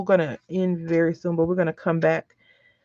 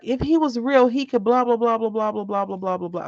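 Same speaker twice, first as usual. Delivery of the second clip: put-on excited child; 5 semitones higher than usual. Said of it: "He was like".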